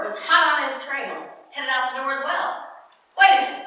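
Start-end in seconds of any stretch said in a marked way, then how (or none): 3.2-3.7 calling out. Acted. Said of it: "said the director".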